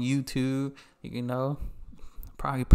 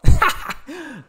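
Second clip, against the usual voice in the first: high loud laugh; burst of laughter; 13 semitones higher, 9 dB louder than usual. Real, not acted. Reactor laughing at his own chant, high loud laugh.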